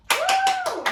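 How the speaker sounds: a high, loud whoop of joy; excited woo. Real, not acted. Dance teacher cheering after finishing the count, excited whoop.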